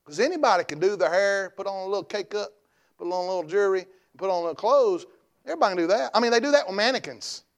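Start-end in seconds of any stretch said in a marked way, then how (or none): none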